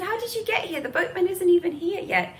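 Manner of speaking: high, incredulous retold question; astonished. Pundit to milkmaid who arrived without the boatman, astonished. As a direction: act out disbelief with the pitch jumping up.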